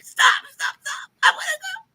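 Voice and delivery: very high, strained squeal through laughter; squeaky mock pleading, nearly breaking up; desperate mock squeal. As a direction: imitate a man about to lose control. Retold desperate man, teller cracking up.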